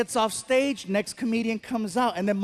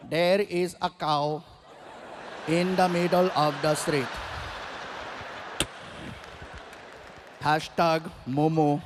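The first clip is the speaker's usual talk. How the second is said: put-on Indian accent; 5 semitones lower, 3 dB quieter than usual. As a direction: read the tweet in a mock Indian accent. Driver tweeting in accent.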